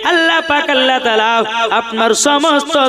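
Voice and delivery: loud, fast intoned storytelling; melodic waz preaching. Acted. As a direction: intone the tale at full voice, stretching phrase ends. (সুরেলা ওয়াজের বয়ান)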